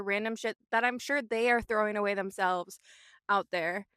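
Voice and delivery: ordinary talk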